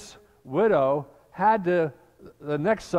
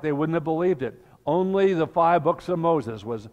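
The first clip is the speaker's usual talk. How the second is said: normal talking voice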